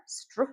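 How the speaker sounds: "str" said as one quick cluster; blended str. Shown as a demonstration. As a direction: run the three sounds together. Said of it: "can have S T R".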